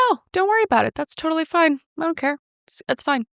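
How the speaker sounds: high, easygoing no-problem voice; breezy reassurance. Retold or imitated. Scene: telling the DoorDasher not to come back, breezy and high.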